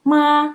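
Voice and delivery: ma held high and level; tone one ma. Shown as a demonstration. Teacher teaching ma for mother, high flat. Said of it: "nada ke satu".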